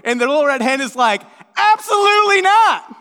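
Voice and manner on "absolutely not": very high, loud, hammed-up refusal; mock-outraged hen shutting them down; indignant refusal.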